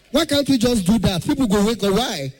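ordinary delivery

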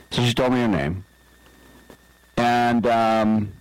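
usual speaking voice

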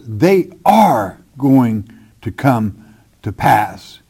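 "are" stressed, the whole phrase slow and deliberate. Acted. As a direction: insist on "are", spoken slowly. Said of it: "not maybe these things".

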